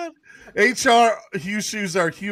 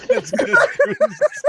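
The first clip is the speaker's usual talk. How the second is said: very high, slow wheeze of laughter; laughing; much slower than usual. Real, not acted. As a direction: barely get it out for laughing. Laughing hard.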